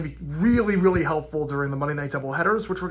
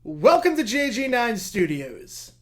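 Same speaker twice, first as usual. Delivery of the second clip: announcer voice; 6 semitones higher than usual. Acted. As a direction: announce it like a showman. Grand announcer intro to video.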